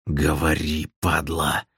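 low, soft rasping order; quiet, wheezed fury; hoarse threat. A narrator's reading. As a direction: rasp it out with menace. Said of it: "просипел Белый".

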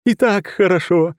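high, loud shout mid-spin; shouting from afar. Acted. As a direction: call out over the battle noise. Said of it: "донеслось Рюкина из крутящегося вихря".

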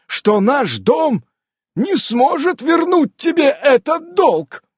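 high, loud, slow outraged cry; keeper's shout. Acted. Old keeper shouting at the amazon, high and loud. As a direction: bellow it at her in outrage.

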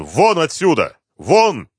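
high, urgent yell; forceful order with the pitch thrown up. A narrator's reading. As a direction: shout to drive someone away. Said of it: "заорал он".

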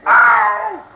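sharp high yelp of pain. Acted. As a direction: cry out as the blow lands. Hit during fight.

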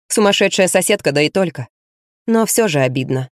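ordinary delivery, nothing marked

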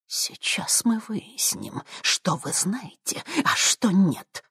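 slow menacing whisper rising to voice; whisper yelling. Acted. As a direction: hiss the threat, then push. Old woman threatening the boy.

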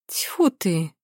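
relief breaking out in a spat-out phew; relieved exclamation. A narrator's reading. Матя hearing it was only the car, relieved. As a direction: let the worry go with a dismissive huff. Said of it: "с облегчением произнесла Матя".

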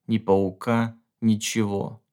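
plain reading voice

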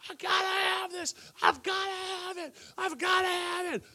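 high, strained mock wail of a kid; tantrum kid. A preacher mimics a child's tantrum, high and wailing. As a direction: wail it like a child throwing a fit in the store. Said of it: "kicking and screaming".

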